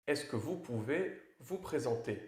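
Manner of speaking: usual speaking voice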